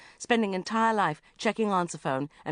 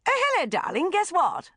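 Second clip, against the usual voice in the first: high, loud gushing mum; gushing mother; 12 semitones higher, 6 dB louder than usual. Acted. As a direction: trill it like an excited mother. Mother voiced on phone.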